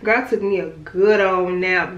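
usual speaking voice

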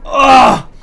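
loud cry of disgust. Real, not acted. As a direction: yelp at a foul taste. Eats a rotten egg bean. (cri de dégoût)

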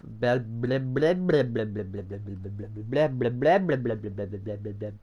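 babbled blahs, rising and falling in waves; tongue-tied gibberish. Real, not acted. Fumbling the name of the firebox, tongue-tied babbling. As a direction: lose the word and blabber it away.

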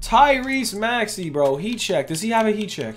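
usual speaking voice

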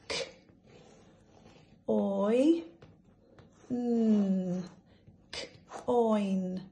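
each sound said apart, slow and separate; segmented phonics sounds; sounding out letters. Shown as a demonstration. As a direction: break the word into its sounds. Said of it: "So let's sound it out".

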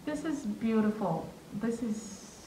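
softened, halting awe; hushed and moved, pausing between phrases; getting emotional. Real, not acted. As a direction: speak softly, overcome with emotion. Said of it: "I'm getting all emotional".

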